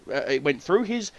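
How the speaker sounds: ordinary talk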